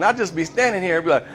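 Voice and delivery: ordinary talk, nothing marked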